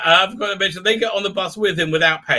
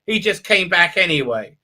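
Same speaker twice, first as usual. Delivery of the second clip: ordinary delivery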